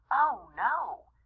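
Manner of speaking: plain reading voice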